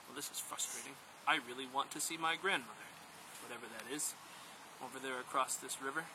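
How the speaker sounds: low, soft, deflated musing; discouraged. Acted. First man stuck at the river's edge, softly discouraged. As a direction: let the voice sink, frustrated and quiet.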